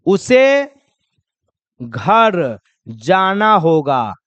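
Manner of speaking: slow, drawn-out model line; slow demo. Shown as a demonstration. Teacher modelling the sentence slowly for students. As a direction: stretch each word. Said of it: "या इसी में कहिएगा".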